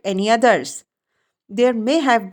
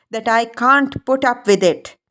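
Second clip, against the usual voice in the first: ordinary delivery, nothing marked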